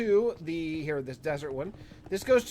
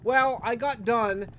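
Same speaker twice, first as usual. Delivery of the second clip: usual speaking voice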